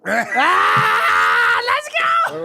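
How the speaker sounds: loud, high celebrating yell; victory shout. Real, not acted. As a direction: cheer the win. Celebrating a right answer.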